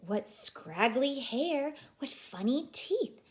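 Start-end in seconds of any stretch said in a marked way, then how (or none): none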